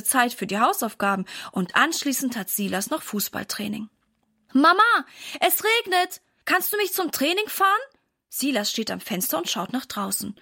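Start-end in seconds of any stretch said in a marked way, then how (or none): 4.5-7.7 put-on high, eager child's plea; lightly voiced pleading kid; child calling to mother.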